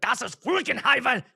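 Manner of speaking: loud exaggerated German accent; hammed-up guttural German accent; mock German. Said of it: "so you're talking in German".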